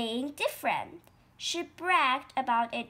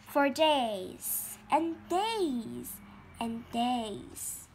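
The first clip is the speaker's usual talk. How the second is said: drawn out; much slower than usual. A narrator's reading. Narrator draws out waiting.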